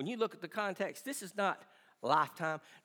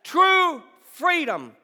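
preaching at full force; 9 semitones higher, 12 dB louder than usual. Acted. Pastor insisting grace is sufficient, building preaching shout. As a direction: hammer it home to the church.

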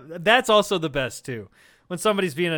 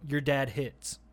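deadpan comeback; 5 semitones lower, 6 dB quieter, slower than usual. Retold or imitated. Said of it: "What I've been saying that usually shuts it down".

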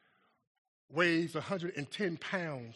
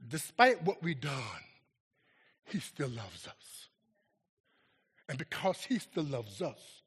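ordinary talk

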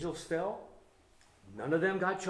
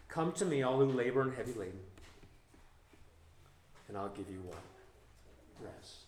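soft gentle reading; 4 semitones lower, 4 dB quieter than usual. Acted. Quoting Jesus again, softer.

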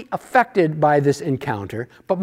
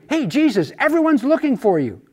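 higher-pitched, eager call-out; exclaiming; 7 semitones higher than usual. Acted. Disciples exclaim to Jesus on finding him, exclaiming. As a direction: call it out as exciting news. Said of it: "they exclaimed".

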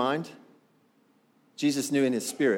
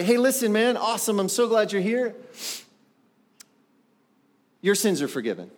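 mock greeting to a visitor; 4 semitones higher than usual. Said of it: "I look down at him and I say".

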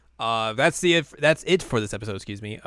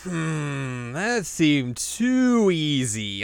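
slow, drawn-out doubt, pitched higher; exaggerated suspicion; 5 semitones higher, much slower than usual. Inuyasha doubting the too-easy win, slow mock suspicion. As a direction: stretch every word out warily.

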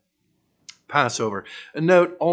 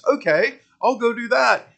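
mock easygoing reply; 9 semitones higher than usual. Mocking the casual-Jesus view.

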